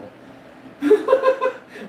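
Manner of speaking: a squealing burst of laughter; high cackling laugh. Real, not acted. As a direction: crack up high and loud. Teller cracks up.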